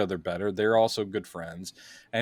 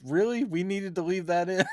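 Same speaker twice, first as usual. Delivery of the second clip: higher, mock-disbelieving; incredulous; 7 semitones higher than usual. Critic scoffing at dialogue left in the film, higher mock disbelief. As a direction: scoff at the leftover line. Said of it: "I'm like".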